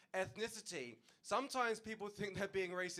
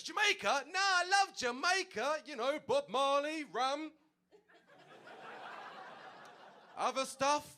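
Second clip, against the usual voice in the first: much higher and louder, slow and overeager; gushing taxi driver; 9 semitones higher, 6 dB louder, slower than usual. Retold or imitated. Cabbie desperately flattering the Jamaican passenger, hammed-up and high. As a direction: oversell the love of Jamaica, high and loud.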